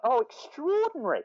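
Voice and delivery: high, drawn-out commentator exclamation; John Motson. Retold or imitated. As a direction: imitate an astonished commentator, stretching the word. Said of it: "so much force in this, in, in, in one word".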